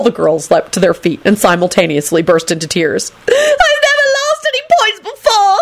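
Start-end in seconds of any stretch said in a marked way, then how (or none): 3.3-5.6 put-on extremely high tearful girl; glass-shattering screech. A narrator's reading. Girl losing house points, glass-shattering screech. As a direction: screech it in dismay. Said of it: "screeched one in a glass-shattering voice".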